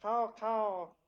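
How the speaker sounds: high, drawn-out raven caw. Acted. Game master voices a raven next door, cawing. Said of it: "You hear a raven".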